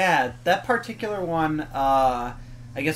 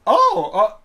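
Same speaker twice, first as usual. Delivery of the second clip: bewildered; 8 semitones higher, 8 dB louder, slower than usual. Retold or imitated. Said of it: "and I'm like".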